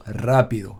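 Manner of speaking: rápido stressed on "rá"; antepenultimate stress. Shown as a demonstration. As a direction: model RÁ-pi-do, rápido, for learners. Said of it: "accentués sur l'antépénultième syllabe".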